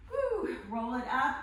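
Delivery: loud whew of relief, laughing; relieved whew. Real, not acted. Relief after a hard hold.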